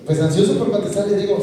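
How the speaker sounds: normal talking voice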